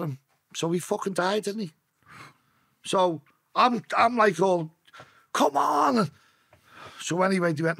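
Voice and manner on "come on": high, stretched-out plea; retold shouting. Willing his flatlined mate back, high retold shout. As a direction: urge him on with a high cry. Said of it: "I'm shouting".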